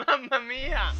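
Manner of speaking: loud, high laughing Italian exclamation; Italian accent. Pretend. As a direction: cry it like a jolly pizza chef. Burnt pizza car, Italian exclamation.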